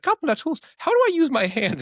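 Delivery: very high, exaggerated clueless questioning; hammed-up squeaky plea for basic help; helpless user. Escalates the helpless user act.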